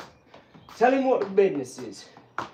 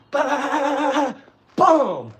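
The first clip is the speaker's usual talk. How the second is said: high rattling "ba-ba-ba", then loud falling boom; vocal sound effects for a combo; imitated punch flurry; 7 semitones higher, 4 dB louder than usual. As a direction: sound out a flurry and the big finish. Imitates himself landing a combo.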